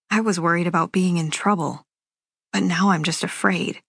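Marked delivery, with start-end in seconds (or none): none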